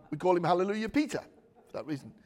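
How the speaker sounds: ordinary talk, nothing marked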